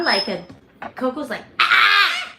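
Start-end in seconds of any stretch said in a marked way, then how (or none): 1.6-2.3 high, loud mock scream; piercing imitated child's scream; screaming little girl imitation. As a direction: imitate a bird shrieking like a six-year-old. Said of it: "Like a screaming, um, sc-, six-year-old girl".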